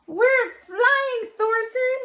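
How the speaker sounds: high, thrilled call; excited shout. A narrator's reading. Lily calls to Thorsten atop the fjord, high and thrilled. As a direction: shout it with glee from the top. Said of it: "Lily shouted".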